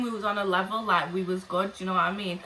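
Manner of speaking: ordinary talk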